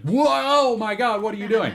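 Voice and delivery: high, slow astonished cry; excited shock. Retold or imitated. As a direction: gasp it out in disbelief. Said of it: "And I was like".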